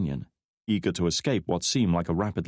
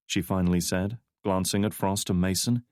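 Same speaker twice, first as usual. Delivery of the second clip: plain reading voice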